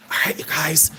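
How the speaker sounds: soft whispered address. Shown as a demonstration. Host whispering to viewers on a dare, whispered. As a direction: whisper it to the audience. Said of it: "I need to whisper".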